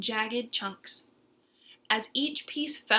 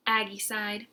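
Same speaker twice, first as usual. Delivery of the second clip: usual speaking voice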